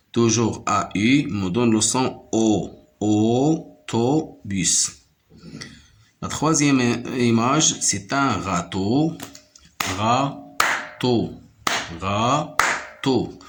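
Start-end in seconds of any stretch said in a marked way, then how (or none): 3.0-4.7 AU-TO-BUS in three slow, separate beats; split into syllables. Shown as a demonstration. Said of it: "se compose de trois syllabes". 10.0-13.4 RÂ-TEAU in two separate beats; Râteau broken in two for a learner; split into syllables. Shown as a demonstration. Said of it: "se compose de deux syllabes".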